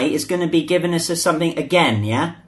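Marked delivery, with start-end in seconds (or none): none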